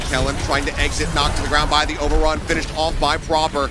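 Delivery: punchy esports call, high at the start; live play-by-play. Real, not acted. Caster calling the final hold, live play-by-play. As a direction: hit the big play, then keep calling.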